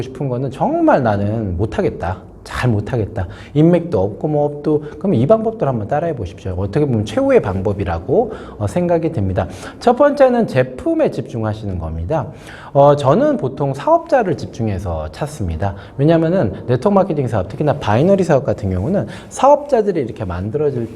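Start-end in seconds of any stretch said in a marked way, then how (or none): none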